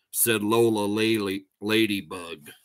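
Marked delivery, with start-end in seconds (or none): none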